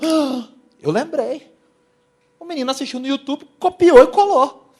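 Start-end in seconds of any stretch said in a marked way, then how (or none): 0.0-0.5 sudden loud "Ah!" as it clicks; realizing where he heard it; surprised gasp of recognition. Retold or imitated. Preacher recalls recognizing the copied sermon, surprised. 3.6-4.7 slow, loud and high outrage; indignant shout. Real, not acted. Preacher denouncing a copied sermon, loud and slow.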